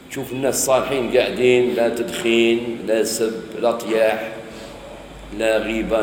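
usual speaking voice

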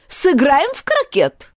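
high, drawn-out queen's call; yelled invitation. A narrator's reading. Queen inviting the girl to play, high slow yell. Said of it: "завопила королева".

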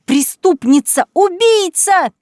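high, loud, slow shrieks; hysterical accusation. Acted. Cousin denouncing Kaitlyn before the court, slow raging shriek. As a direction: drag out each insult, raging. Cousins attack her publicly.

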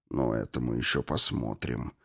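very low, quiet menace; grim. A narrator's reading. Destroyer answers the defiant captive, grim low threat. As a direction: drop it low and soft, darkly. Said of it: "мрачно проговорил губитель".

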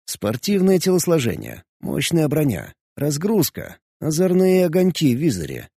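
ordinary delivery

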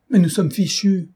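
high cry of doom; panicked. A narrator's reading. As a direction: passenger sure of a crash. Passenger fears the crash.

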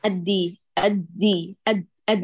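أدي with the d held before release; held doubled dal. Shown as a demonstration. Teacher drilling the held doubled dal. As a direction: stop on the dal in أد, then release. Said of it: "أثبتي لي تضعيف الدال".

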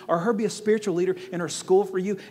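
ordinary talk

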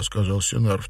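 ordinary delivery, nothing marked

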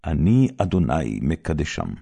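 ordinary talk, nothing marked

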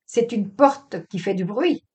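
sharp retort. Acted. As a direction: answer back sharply, rising in force. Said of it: "osa-t-il répliquer vertement".

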